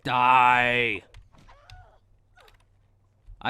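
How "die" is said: loud, drawn-out playful yell; mock battle cry. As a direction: shout it long as you attack in a game. Attacking enemies in the game.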